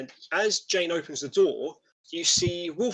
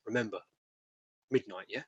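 confiding lean-in; 4 semitones lower, 7 dB quieter, faster than usual. Acted. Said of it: "leans in again and goes".

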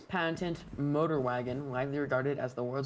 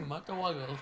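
usual speaking voice

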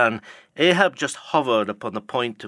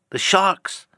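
The sharks! cried much higher and louder; higher alarmed cry; 9 semitones higher, 4 dB louder than usual. Acted. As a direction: push The sharks! up into a panicked shriek. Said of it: "cried a voice from the low cabin window".

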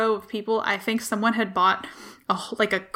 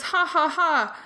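spelt-out mock laugh, pitched up; imitating a stranger's hearty "ha, ha, ha"; imitated laugh; 6 semitones higher, slower than usual. Vaper laughing with the man beside him, imitated laugh. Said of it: "the other guy laughs".

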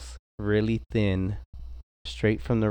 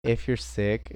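ordinary talk

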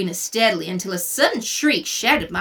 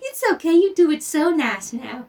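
mimicking sweet boy; 7 semitones higher than usual. Mimics Chuck's reassurance.

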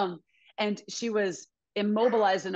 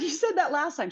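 teasing reply; 10 semitones higher than usual. Retold or imitated. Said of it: "I was like".

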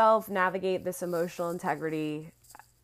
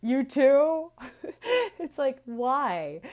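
higher, laughing, teasing; 6 semitones higher, 5 dB louder, slower than usual. Real, not acted. Host laughing at shared distraction excuses, teasing laugh. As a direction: tease them through a laugh.

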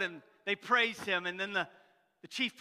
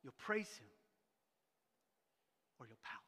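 quiet repeat; 9 semitones lower, 13 dB quieter than usual. Shown as a demonstration. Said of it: "you'll have two responses today".

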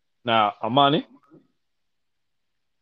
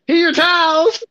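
much higher, louder, sugary fake politeness; fake polite; 12 semitones higher, 4 dB louder than usual. Handing guests the towels he pissed on, fake sweet and much higher. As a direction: hand them over all innocent.

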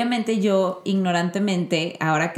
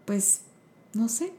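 sheepish mumble; 8 dB quieter, slower than usual. Retold or imitated. Mother's sheepish answer to son.

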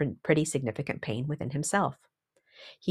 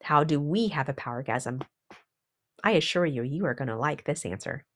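ordinary talk

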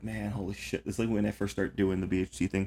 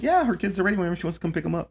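fast, higher phone voice; quick breezy official; 8 semitones higher, much faster than usual. Retold or imitated. Caseworker imitating a case manager on the phone, fast and higher. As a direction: rattle it off cheerfully. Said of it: "she's like".

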